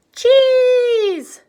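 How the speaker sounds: very high loud wailing plea; begging children. Acted. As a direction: cry out like starving kids. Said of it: "they pleaded".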